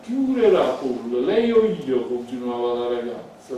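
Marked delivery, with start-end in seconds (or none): 0.0-2.0 loud demanding question that climbs high; angry challenge. Acted. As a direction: throw the question at him. Elisabetta challenges Gerard. (domanda furiosa e alta)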